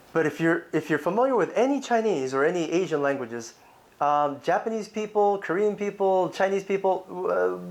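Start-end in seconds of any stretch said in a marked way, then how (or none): none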